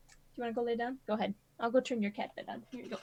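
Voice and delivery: soft pet talk. Real, not acted. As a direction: coo gently to the cat. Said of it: "still talking to the girl".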